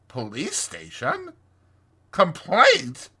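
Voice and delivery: dazed Toad, rising questions; dreamy Toad. A narrator's reading. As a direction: echo the words in dreamy disbelief. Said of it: "murmured Toad dreamily".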